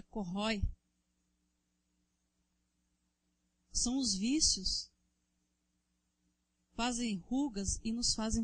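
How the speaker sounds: loud, driving sermon to the congregation; fervent preaching. Acted. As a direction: preach it hard, calling out to the brothers. Preaching at full force. (pregação inflamada e forte)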